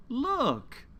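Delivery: a high, bright call of look; excited look. Acted. Storyteller showing children a new bird, excitedly high. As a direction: point it out with a sudden burst of delight.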